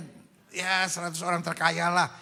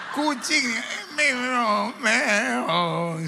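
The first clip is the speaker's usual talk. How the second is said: higher, drawn-out comic meow; stricken cat; 5 semitones higher, 3 dB louder, much slower than usual. Acted. Joking about cats stricken by mama's nagging, comic stricken meow. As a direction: imitate a poorly cat meowing. Imitating a cat having a stroke.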